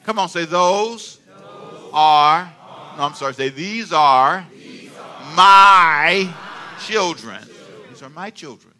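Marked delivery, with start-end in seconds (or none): 0.4-2.5 "Those", pause, "are", spaced for repeating; slow and deliberate. Shown as a demonstration. Pastor feeding words to congregation, slow "Those are". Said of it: "Can you say it slow?". 3.5-7.4 put-on "These are... my... children" with big pauses; slow and deliberate. Shown as a demonstration. Pastor leading congregation to repeat, slow emphatic "my". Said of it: "Can you say it slow?".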